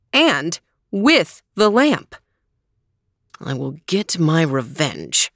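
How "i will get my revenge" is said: lower, menacing sworn vow; a villain swearing revenge under his breath; vengeful vizier. A narrator's reading. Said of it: "swore the vizier".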